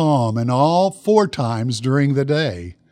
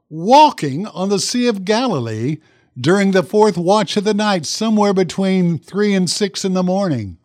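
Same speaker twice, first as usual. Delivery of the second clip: ordinary talk, nothing marked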